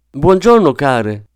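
high, loud hailing call; loud, high greeting that drops at the end. A narrator's reading. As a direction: call out to both sisters. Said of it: "gridò la signora Lewis".

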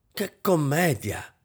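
slow, high hiss through gritted teeth. A narrator's reading. Husband scorning his wife's hysterics, hissing. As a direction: hiss it in contempt, teeth clenched. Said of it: "sibilò Kampf a denti stretti".

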